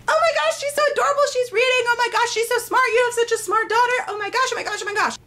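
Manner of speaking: high-pitched mock gushing; gushing admirers. Family friends fawning over a toddler reading, mock gushing. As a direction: imitate doting grown-ups in a high, breathless-with-praise rush. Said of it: "everyone was always like".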